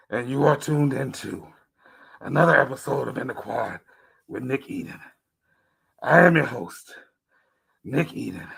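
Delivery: rough throaty demon voice; messed-up throat demon voice. Acted. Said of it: "Try to sound like a demon, mess your throat up".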